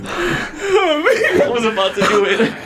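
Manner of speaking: squeaky helpless laughter through words; can't get it out for laughing; laughing too hard to talk. Real, not acted. Laughing fit while telling.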